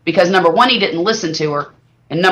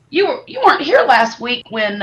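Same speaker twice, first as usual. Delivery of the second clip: usual speaking voice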